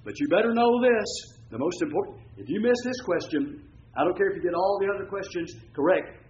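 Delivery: stern teacher warning. Retold or imitated. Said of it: "When I taught school, I tell the kids".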